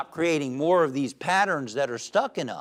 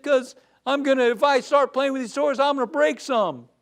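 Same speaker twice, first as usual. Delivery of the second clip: put-on higher, fretful child's voice; a gloomy boy afraid of breaking toys; gloomy twin explaining to the psychiatrist, higher and fretful; worried little kid; 7 semitones higher, 5 dB louder than usual. Pessimistic kid in joke.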